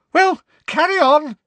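shrill-edged fortune teller barking an order; loud. A narrator's reading. Trelawney to the class, loud and high. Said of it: "said Professor Trelawney loudly".

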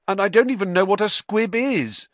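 high, loud protest; indignant denial; said loudly. A narrator's reading. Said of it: "Harry said loudly".

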